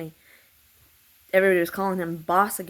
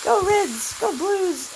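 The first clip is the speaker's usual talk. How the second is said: mocking him; 7 semitones higher than usual. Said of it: "he'd be like".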